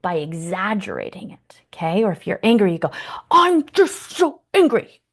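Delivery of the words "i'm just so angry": high, loud, slow mock fury; overacted tantrum, much higher and louder; exaggerated anger. Shown as a demonstration. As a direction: ham up the anger playfully. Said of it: "And you can exaggerate that".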